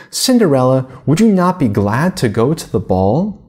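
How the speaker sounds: a mild, sly jeer at the stepsister; mocking. Stepsisters lightly mocking Cinderella about the ball, a touch of jeering. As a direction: tease her with mock kindness. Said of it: "you only jeer at me".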